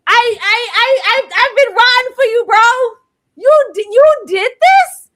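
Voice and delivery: betrayed outcry. Real, not acted. Fan betrayed by Lestat, wailing loud and high. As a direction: cry out like a betrayed friend.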